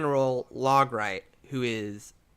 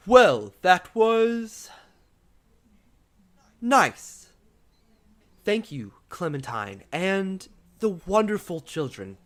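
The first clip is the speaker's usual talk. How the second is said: a hint of a regal king; 6 semitones higher, 5 dB louder, slower than usual. King thanks the dancers.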